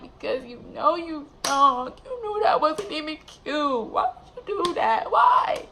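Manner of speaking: high-pitched, indignant telling-off, rising to a squeal on why; retold outrage. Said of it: "I was so pissed".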